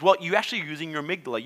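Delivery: ordinary talk, nothing marked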